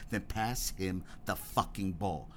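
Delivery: slow, low and even, quiet menace; spoke evenly. Retold or imitated. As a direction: lay it down calmly and slowly. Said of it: "Jackson spoke evenly".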